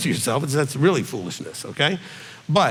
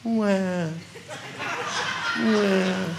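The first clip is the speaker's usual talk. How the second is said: newborn crying; 4 semitones higher, 6 dB quieter than usual. Retold or imitated. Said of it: "my son came out, it was like".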